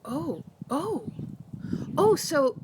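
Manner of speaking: the penny drops for the client; dawning realisation. Retold or imitated. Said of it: "she's like".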